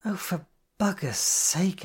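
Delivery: a hint of fed-up sigh in the words; exasperated sigh. A narrator's reading. Friend fed up with Astrid's self-doubt, sighing heavily. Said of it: "Jen sighed heavily".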